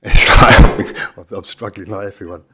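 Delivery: hoarse, almost voiceless, half laughing; losing voice. Real, not acted. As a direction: push words through a failing voice. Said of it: "I'm losing my voice here".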